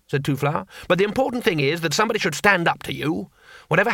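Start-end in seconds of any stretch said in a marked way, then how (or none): none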